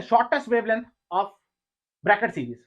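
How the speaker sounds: Shortest wavelength dictated slowly; dictating to the class, word by word; slow dictation. Shown as a demonstration. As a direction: spell out the question slowly. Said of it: "मैं बोलता हूँ सीधे".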